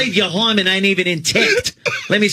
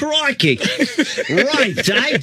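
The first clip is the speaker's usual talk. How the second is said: put-on Aussie voice, laughing through it; hammed Aussie slang, a touch higher; light Australian jock impression; mock Australian accent; 4 semitones higher, slower than usual.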